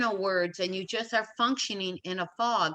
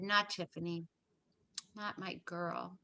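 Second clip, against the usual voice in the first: very soft, slowing, almost to herself; retold grieving disbelief; 3 semitones lower, 13 dB quieter than usual. Mother seeing highway patrol arrive, hushed retold grief. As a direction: let it sink to a hushed plea. Retelling first reaction to daughter's death.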